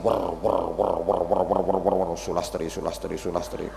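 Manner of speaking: low muttered chant of names; mock murmured wirid. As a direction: mumble it like a rote dhikr. Old man's murmured wirid. (wirid digumamkan lirih)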